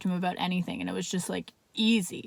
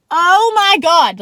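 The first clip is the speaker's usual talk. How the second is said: loud, higher, drawn-out cry of delight; excited outburst; 7 semitones higher, 12 dB louder, much slower than usual. Retold or imitated. Woman reacting to her boss giving her the night off, thrilled. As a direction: burst out with slow, thrilled disbelief.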